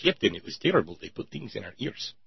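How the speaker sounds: lightly voiced Star Trek Russian accent; a hint of Chekhov reporting to the captain; a light Russian accent, Chekhov to his captain; Chekhov's Russian accent. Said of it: "and Chekhov".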